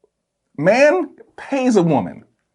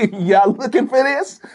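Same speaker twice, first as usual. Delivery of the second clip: put-on baby voice; 5 semitones higher than usual. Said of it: "He said".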